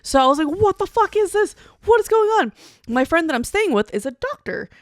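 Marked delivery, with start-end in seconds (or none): 0.5-2.5 high, loud panicked cry; panicked confusion. Retold or imitated. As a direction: yell out in hungover panic. Retold panic while hungover.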